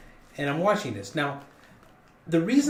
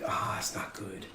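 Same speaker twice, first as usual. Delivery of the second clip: muttered worry; 4 semitones lower, 8 dB quieter, slower than usual. Retold or imitated. Quiet aside to self on plane.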